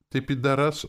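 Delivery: high, earnest question; sudden, caring inquiry; sympathetic. A narrator's reading. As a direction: ask with innocent concern. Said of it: "вдруг участливо спросил".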